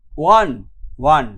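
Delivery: ওয়ান said clearly as "wan", not "own"; pronounce won as "wan". Shown as a demonstration. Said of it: "তোমরা এখানে উচ্চারণটা করবে ওয়ান".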